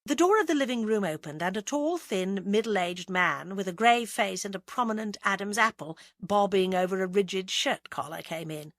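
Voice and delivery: ordinary delivery, nothing marked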